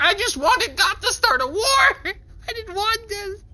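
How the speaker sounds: high, laughing-crying wail; crying protest. Acted. Player cries that he never wanted a war, high laughing wail. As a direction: sob it out, half laughing. Panicked cry.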